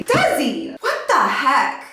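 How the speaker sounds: high disbelief at sister; high surprised call; shocked exclamation. Real, not acted. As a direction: call out her name high.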